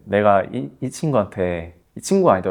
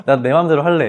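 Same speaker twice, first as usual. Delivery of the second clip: higher and louder, laughing through a willful line; laughing mock stubborn AI; 6 semitones higher, 8 dB louder than usual. Guest imitating her headstrong AI tool, laughing and louder. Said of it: "여기에 얘까지 들어가 버리니까".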